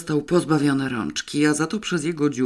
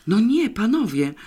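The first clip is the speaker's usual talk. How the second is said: displeased; 7 semitones higher, 4 dB louder than usual. A narrator's reading. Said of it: "z niezadowoleniem".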